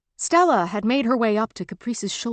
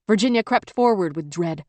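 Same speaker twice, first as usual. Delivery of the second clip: ordinary delivery, nothing marked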